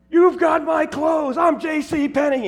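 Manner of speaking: high, loud, slow mock roar; mock shout. Friend in bear rug chasing the MC, mock roar. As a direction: bellow it like a man in a bear rug. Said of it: "he goes".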